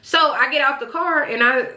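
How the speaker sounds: ordinary talk, nothing marked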